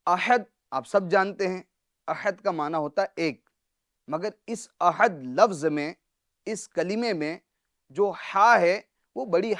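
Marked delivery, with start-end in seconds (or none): none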